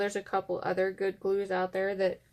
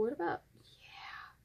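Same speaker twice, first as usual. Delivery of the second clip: whispering; 6 semitones higher, 11 dB quieter than usual. Real, not acted. Muttering to herself while crafting.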